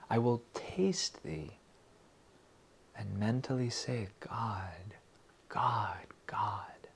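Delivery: slow, soft, savouring; soft whisper. Shown as a demonstration. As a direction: whisper it as if tasting sweetness. Tasting, whispered.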